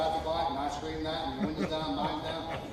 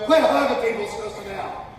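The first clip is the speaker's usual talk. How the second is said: loud mock scolding of mom; 5 semitones higher, 10 dB louder than usual. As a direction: tell mom off, half joking. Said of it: "You know".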